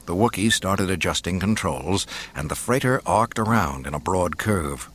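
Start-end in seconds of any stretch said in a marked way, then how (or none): none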